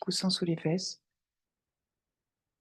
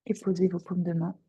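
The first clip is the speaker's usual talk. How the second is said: guided meditation; 4 dB louder than usual. Acted. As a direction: guide them gently into their breath. Guide une méditation. (voix de méditation guidée, calme et posée)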